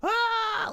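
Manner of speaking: high shrill mock scream of passengers; mock crowd scream. Passengers in a diving plane, a high mock scream. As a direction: let out a high crowd shriek. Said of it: "everybody's like".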